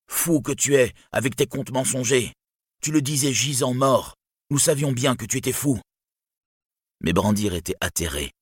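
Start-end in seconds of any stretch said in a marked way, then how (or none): 0.1-5.8 high, scornful crowd shout; angry cry. A narrator's reading. Villagers rounding on Brandir for his false tale, crying out. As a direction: cry out an accusation, pitched well up. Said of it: "lui crièrent".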